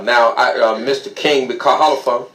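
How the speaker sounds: ordinary delivery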